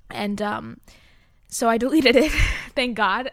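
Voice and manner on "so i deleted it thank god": loud laughing relief. Real, not acted. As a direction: laugh it out loudly. Relieved laugh about deleting.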